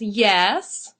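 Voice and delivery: loud, daring reply; expectant challenge. Acted. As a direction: answer as if daring the bird. Lady pauses, ready for the parrot.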